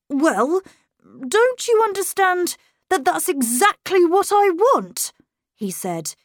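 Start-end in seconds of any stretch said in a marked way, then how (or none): 0.1-5.1 put-on higher boy's triumphant retort; a cocky child scoring a point. A narrator's reading. Boy tells governess it's what he wants, cocky.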